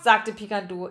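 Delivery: ordinary delivery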